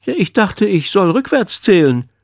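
hohe, verstellte Kinderstimme; protestierender Schüler. A narrator's reading. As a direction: rechtfertige dich hoch wie ein Schuljunge. Schüler Mika rechtfertigt sich. (Schüler, der sich herausredet)

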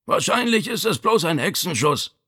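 high-pitched ailing father; weak man calling from bed; Vito. A narrator's reading. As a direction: play down the pain, pitched high. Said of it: "kam Vitos schwache Stimme".

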